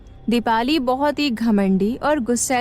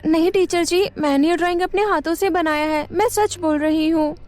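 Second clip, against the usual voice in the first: put-on pleading girl; 6 semitones higher, faster than usual. Girl defends herself to teacher.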